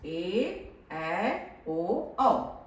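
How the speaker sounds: ए, ऐ, ओ, औ each held long; long vowels. Shown as a demonstration. Teacher letting pupils feel the doubled time, drawn out. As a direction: stretch every vowel separately to double length. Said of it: "इस तरह से दीर्घ स्वर".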